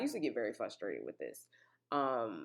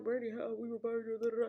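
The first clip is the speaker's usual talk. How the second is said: mocking friend's voice; 9 semitones higher than usual. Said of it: "And she was like".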